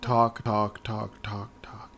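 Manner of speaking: droning on, fading to a whisper; fading talk. Retold or imitated. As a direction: let it trail off into a whisper, bored. Mocking endless dialogue, fading out.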